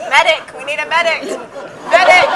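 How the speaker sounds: loud mock call for a medic; man down shout. Burning mouth, calling for help.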